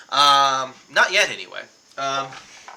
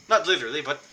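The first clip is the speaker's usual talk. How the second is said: ordinary talk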